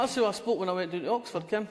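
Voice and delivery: light Fife Scots ending on "Ken"; broad Fife Scots. Shown as a demonstration. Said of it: "where we talk like this".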